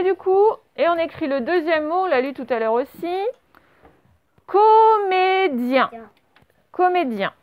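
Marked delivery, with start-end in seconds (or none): 4.5-5.9 very slow, high, syllables held long; high, stretched-out dictation voice. Shown as a demonstration. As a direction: sing out each syllable for pupils writing it down. Dictating a word to pupils.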